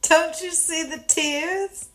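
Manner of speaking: soft, slow, high pretend whimper; mock tearful. Listener playing the lying kindergartner for the teacher, mock tearful. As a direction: mimic a child milking fake tears. Listener mimics the fake-crying kid.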